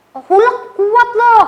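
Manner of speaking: high, loud, drawn-out kiddie boast; boasting little friend. Retold or imitated. Friend boasts that Hulk is strong, high kiddie voice. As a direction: brag like a small kid about a hero. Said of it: "eh, temennya bilang".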